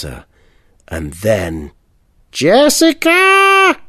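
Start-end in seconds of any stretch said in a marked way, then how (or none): 2.3-3.8 long, high, loud call of a name; calling down from balcony. A narrator's reading. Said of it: "her mum shouted from the balcony".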